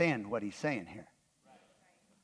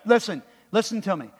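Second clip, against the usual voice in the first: ordinary delivery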